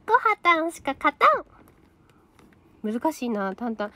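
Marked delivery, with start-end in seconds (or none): 0.1-1.5 lightly higher put-on idol voice, rising at the end. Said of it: "七期全員[name]のものまねできる".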